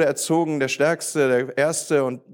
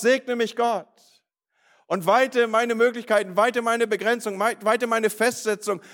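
high, insistent prayer, volume held; acted crying out; 6 semitones higher than usual. Jabez crying out his prayer to God, high and insistent. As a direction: plead in raised pitch, driving each petition home. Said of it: "er schreit es raus".

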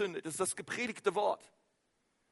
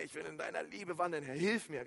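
much softer, a plea to the Lord; softly voiced prayer; 4 semitones lower, 9 dB quieter than usual. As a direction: drop to a soft, intimate prayer. Voices a quiet personal prayer.

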